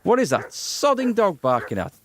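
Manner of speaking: fed up with the barking dog; exasperated. Real, not acted.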